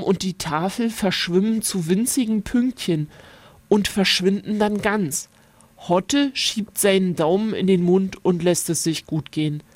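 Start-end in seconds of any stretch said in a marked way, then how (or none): none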